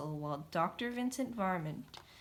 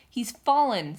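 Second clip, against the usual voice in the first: exclaiming mouse; 5 semitones higher, 10 dB louder than usual. A narrator's reading. Mouse reacts loudly.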